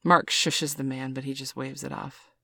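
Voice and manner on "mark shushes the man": ordinary delivery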